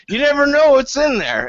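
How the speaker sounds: high mock drunk voice; a high, giddy drunk voice; drunk player.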